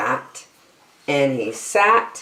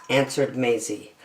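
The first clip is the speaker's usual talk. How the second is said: ordinary delivery, nothing marked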